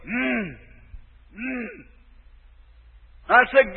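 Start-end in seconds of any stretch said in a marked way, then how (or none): 0.1-1.8 faint low moaning hum, barely voiced; dying man's moan. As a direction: moan softly like a dying man. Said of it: "had the blanket in his hand going".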